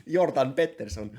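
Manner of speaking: name said in mock Swedish; loud, jokey Swedish accent; Jordan Peterson, Swedish accent. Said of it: "like, a Swedish accent for him".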